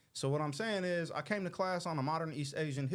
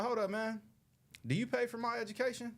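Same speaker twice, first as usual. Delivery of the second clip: higher, quick confronting question; retold challenge; 10 semitones higher than usual. Student retelling how he confronted his professor, challenging. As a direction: challenge him, pitched up and brisk. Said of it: "I was like".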